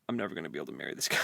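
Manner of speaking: low, fast rushed lament; fast mock despair. Sarah moaning she can never marry him, fast and low. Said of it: "Sarah's like".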